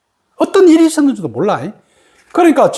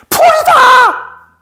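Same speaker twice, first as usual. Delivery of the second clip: high, loud, drawn-out cries of discovery; retold yell, much higher and held long; acted awestruck shout; 13 semitones higher, 4 dB louder, slower than usual. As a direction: cry out in overwhelmed amazement. Retells friend's cry of awakening.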